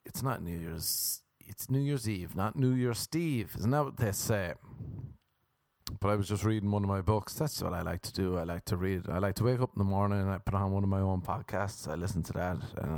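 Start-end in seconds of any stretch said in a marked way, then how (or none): none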